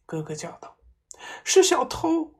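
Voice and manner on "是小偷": high, loud terrified cry; wife shrieking about a thief; trembling fear. A narrator's reading. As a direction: gasp it out in terror. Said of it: "妈妈颤抖着说".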